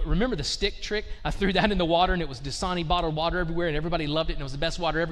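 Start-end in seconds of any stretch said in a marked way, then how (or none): none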